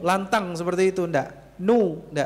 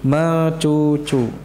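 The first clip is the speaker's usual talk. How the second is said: Mecucu stretched slowly and louder; drawn-out mecucu; 3 semitones lower, 5 dB louder, much slower than usual. Shown as a demonstration. Teacher stressing Mecucu so students remember it. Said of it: "Isyarat mecucu".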